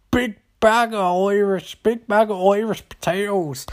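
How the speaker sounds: high, hammed-up Irish accent; very Irish guy. Irish hotel man listing goods to lawyer, exaggerated Irish accent. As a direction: stretch it out in silly stage Irish. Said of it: "there's this very Irish guy".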